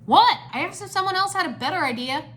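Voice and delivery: high, loud indignant start, then protest; defensive. A narrator's reading. Kai defends his failed plan, defensive.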